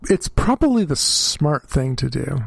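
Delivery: usual speaking voice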